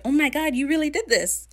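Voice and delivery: high excited astonishment; mock amazement. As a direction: gasp it out in disbelief. Crew amazed at Steed.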